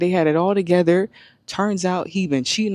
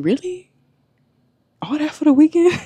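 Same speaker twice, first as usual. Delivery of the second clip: very high, mock-appalled questions; retold incredulity; 11 semitones higher, 4 dB louder than usual. Woman recounting her reaction to a concertgoer's skirt, incredulous. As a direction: question it in exaggerated disbelief, voice shooting up.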